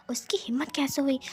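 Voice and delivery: hissed whisper, indignant; furious hush; angry whisper. Acted. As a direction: whisper the outrage so nobody wakes. Sneaking at night, whispering.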